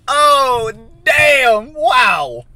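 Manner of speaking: high, very loud drawn-out yell; blown-away shout; amazed delight. Real, not acted. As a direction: exclaim loudly at a delicious taste. Tastes the milkshake.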